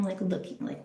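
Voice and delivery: ordinary talk, nothing marked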